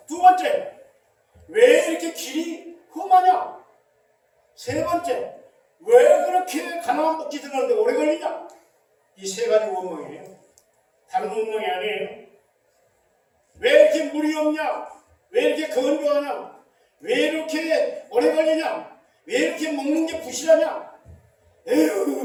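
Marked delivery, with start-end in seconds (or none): 1.5-3.6 slow, loud grumble about the rough road; whiny complaint. Acted. Preacher voicing the Israelites grumbling, slow loud whine. 5.8-8.5 grumbling complaint. Acted. Voicing Israelites' complaint. 13.6-20.9 loud, higher whining list of gripes; grumbling complaints. Acted. Preacher acting out the Israelites complaining in the desert, loud whine.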